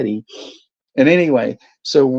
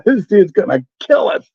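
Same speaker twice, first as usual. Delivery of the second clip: mock panic; 8 semitones higher, 3 dB louder than usual. Terrified in the car.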